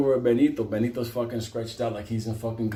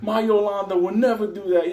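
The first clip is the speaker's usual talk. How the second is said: higher, louder, offended; indignant mock protest; 10 semitones higher, 5 dB louder than usual. Inmate insisting his Yolanda is loyal, higher and offended. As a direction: defend her honour. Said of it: "he was like".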